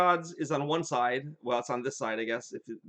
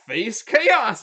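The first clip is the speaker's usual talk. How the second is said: louder, commanding shout of the spell; 6 semitones higher, 9 dB louder than usual. Retold or imitated. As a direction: bark the spell out loud and high. Greg casting spell again.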